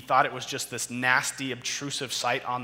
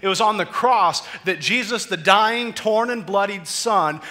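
louder and higher, building to a peak; preaching at full force; 5 semitones higher, 10 dB louder than usual. Acted. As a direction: preach it, rising with every line. Sermon climax on the cross.

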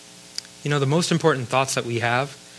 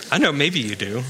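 laughing. Real, not acted. Laughing aside.

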